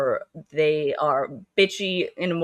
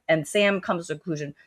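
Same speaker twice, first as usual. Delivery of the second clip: ordinary delivery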